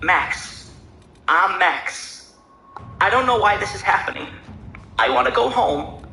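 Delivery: a frightened man close to tears; trembling voice. A narrator's reading. Max tells the others he wants to go home, trembling. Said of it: "his voice trembling as he spoke".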